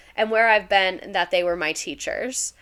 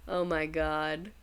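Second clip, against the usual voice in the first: ordinary delivery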